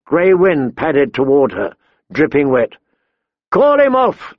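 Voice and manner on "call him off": high-pitched, urgent shout; shouted command. A narrator's reading. Big man fending off the wolves, shouting. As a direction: yell the order, pitched well up. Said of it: "the big man shouted".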